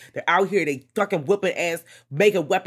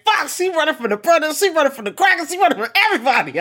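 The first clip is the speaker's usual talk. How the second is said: high, loud laughing rant; high, loud exasperated cry, laughing; laughing outburst; 12 semitones higher, 6 dB louder, faster than usual. Retold or imitated. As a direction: squeal it out through laughter. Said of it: "I said".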